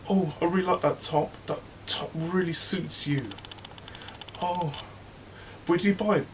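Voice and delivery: high gushing fake praise; fake-sweet gossip. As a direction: compliment her top insincerely, high. Mother hen fake compliment.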